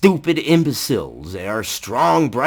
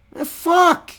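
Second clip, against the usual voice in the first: incredulous. Real, not acted. Incredulous outburst.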